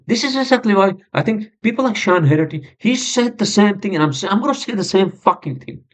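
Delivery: worked-up, high-pitched rant; frustrated. Real, not acted. Podcaster venting about the state's penalties, frustrated. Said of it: "I'm just showing my frustration".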